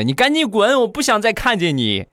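high, furious shouting; angry yelling. Acted. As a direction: drive him away with a scream. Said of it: "一看她这个样儿更生气了".

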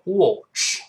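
"watch" the British way, rounded o; British "watch" with an o vowel; British pronunciation. Shown as a demonstration. Teacher contrasting accents for learners, British rounded "watch". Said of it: "英国人就".

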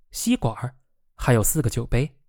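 ordinary delivery, nothing marked

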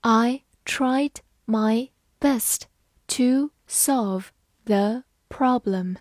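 slow, deliberate read. Shown as a demonstration. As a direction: read it slowly word by word. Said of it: "我们把完整的句子慢慢来读一遍".

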